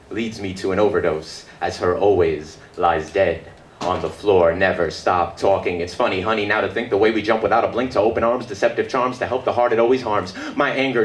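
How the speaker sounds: usual speaking voice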